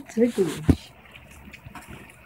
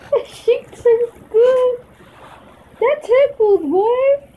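high giggling, half crying laughter; laughing; 10 semitones higher than usual. Real, not acted. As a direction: laugh helplessly through it. Dog licking, laughing.

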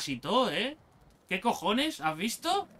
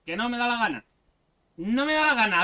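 stubborn refusal; 3 semitones higher, 6 dB louder than usual. Real, not acted. Refusing to build raft.

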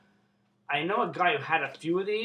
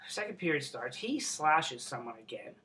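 ordinary delivery

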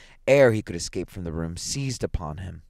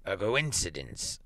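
put-on slow, faltering old man; hesitant, shaken and subdued; stammering; 5 dB quieter, slower than usual. A narrator's reading. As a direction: stumble weakly over the word. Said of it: "stammered the old man".

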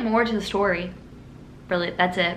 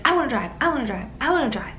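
lightly imitated pleading kid, quick and higher; pestering little sister; 4 semitones higher, much faster than usual.

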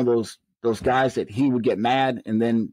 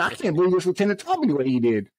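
higher-pitched mock disbelief; retold indignation; 5 semitones higher than usual. New lieutenant complaining about how he was spoken to, retold indignation. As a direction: replay being upset at the insult. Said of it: "And I'm mad now. I'm upset".

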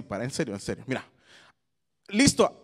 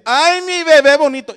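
loud, high doting coo; high-pitched fawning over a baby; baby talk; 10 semitones higher, 11 dB louder than usual. Shown as a demonstration. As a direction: gush over a cute baby. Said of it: "vas a acariciar y decir".

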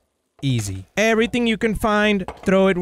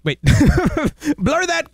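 ordinary talk

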